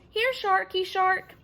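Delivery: high, slow sing-song taunt; boy mocking the danger; teasing call. A narrator's reading. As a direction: lure the shark like a pet. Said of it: "Monty called through the cupped hands".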